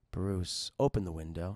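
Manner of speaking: very low, eerie command; deep haunting voice giving orders; voice in the head. Acted. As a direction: urge him from inside his head, low. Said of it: "hearing a voice inside his head".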